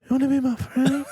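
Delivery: creepy-friendly in his light voice; killer impression. Doing the killer's voice.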